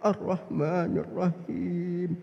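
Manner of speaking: slow, chant-like prayer with long vowels; drawn-out reverent recitation; devout recitation. Retold or imitated. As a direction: imitate a worshipper deeply absorbed. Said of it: "Nangis dia".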